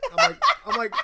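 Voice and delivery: high squealed lead-in; excited squeal. Real, not acted. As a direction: squeak it out laughing.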